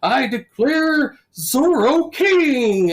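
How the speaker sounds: slow, high, loud royal decree; King Arthur proclaiming. Retold or imitated. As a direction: proclaim it grandly from a balcony, hammed up. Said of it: "the King Arthur now is in".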